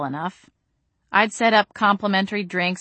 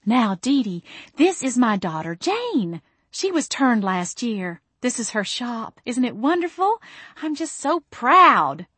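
high, slow cooing mama voice; cooing mother; 6 semitones higher than usual. A narrator's reading. Mother introducing her daughter to Dee Dee, high sweet coo. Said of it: "Mama cooed".

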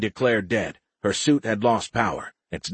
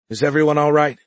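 raised, higher call to the team; 6 semitones higher, 6 dB louder, faster than usual. A narrator's reading. Leader checking on her team over the channel, calling out. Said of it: "she called on the team channel".

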